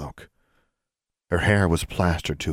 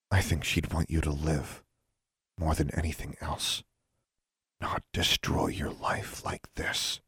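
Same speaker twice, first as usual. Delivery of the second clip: leaning in to whisper; 7 dB quieter than usual. Acted. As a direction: drop to a near whisper. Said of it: "Alexandra lowered her voice".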